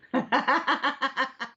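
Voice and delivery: high, rippling run of laughter; laughing. Real, not acted. Co-host teased about sleeping, laughing. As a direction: burst into giggles at the teasing.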